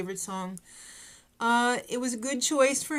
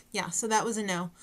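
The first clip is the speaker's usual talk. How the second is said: ordinary delivery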